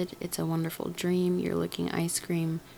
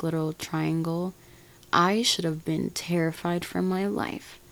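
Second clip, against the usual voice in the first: normal talking voice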